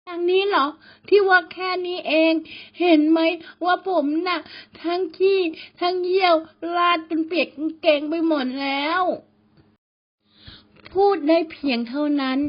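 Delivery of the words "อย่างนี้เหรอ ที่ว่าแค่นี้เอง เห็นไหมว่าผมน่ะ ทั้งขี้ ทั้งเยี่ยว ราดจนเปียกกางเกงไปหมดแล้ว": much higher, frantic and pleading; a wet, terrified kid protesting to a ghost; shrill frightened whine; panicked boy. A narrator's reading. Scared boy answers the ghost.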